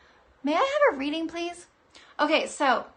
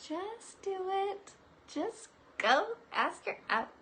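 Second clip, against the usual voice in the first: spirit guides; 8 semitones higher, slower than usual. Pretend. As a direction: coax like an unseen guide. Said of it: "they're saying".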